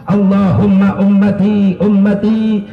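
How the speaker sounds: high, insistent cry to God; tearful plea. Retold or imitated. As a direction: plead over and over, pitch climbing and staying high. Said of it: "maka nangislah Rasulullah". (ngadu'a satengah ceurik, sora luhur)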